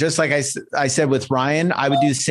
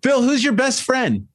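playful caller; 11 semitones higher than usual. Pretend. Role-play question to prospect.

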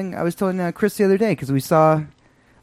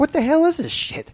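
mock disbelief; 11 semitones higher than usual. Bus rider reacting to nice bus.

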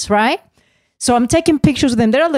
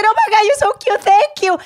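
nervous gushing; 10 semitones higher than usual. Retold or imitated. Retelling nervous cute reply.

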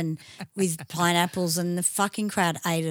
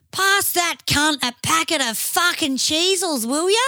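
put-on a half deaf seventy-year-old hollering across the pub; old Aussie drinker's bellow, pitched up high; half deaf old man; 10 semitones higher, 4 dB louder than usual. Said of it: "he turns around. He's half deaf, and he goes".

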